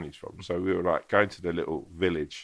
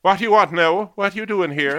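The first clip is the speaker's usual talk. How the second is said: high, loud Irish landlady voice; landlady's voice; 12 semitones higher, 12 dB louder than usual. Retold or imitated. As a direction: bark it out like a brusque old landlady. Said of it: "and she went".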